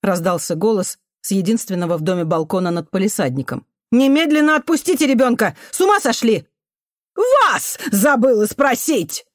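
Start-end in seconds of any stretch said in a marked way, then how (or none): 3.9-6.5 high, raised commanding call; indignant order. A narrator's reading. Balcony neighbour to the janitor holding a child, outraged. 7.2-9.3 high, slow, sarcastic shout; angry retort. A narrator's reading. Janitor woman to the neighbour on the balcony, angry.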